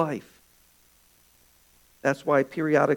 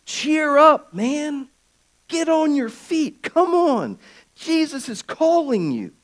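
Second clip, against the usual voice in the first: eager encouragement; 12 semitones higher, 7 dB louder, slower than usual. Retold or imitated. Crowd now urging him on.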